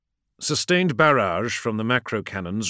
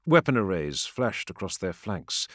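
ordinary delivery, nothing marked

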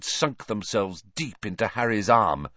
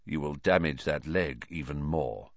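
quiet; 8 semitones lower, 6 dB quieter than usual. A narrator's reading. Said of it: "he said quietly to Ron".

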